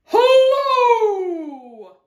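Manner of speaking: long, high, loud holler; shouted greeting. Acted. Tortoise greeting the cactus, shouting high and long. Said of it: "shouted a tortoise".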